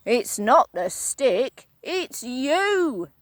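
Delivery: Mister Twit, grinning horribly. Acted. Husband blaming his wife, wicked grin. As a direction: savour each word wickedly. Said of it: "said Mister Twit".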